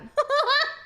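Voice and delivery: high cackle. Real, not acted. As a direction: burst into a cackle. Reaction laugh.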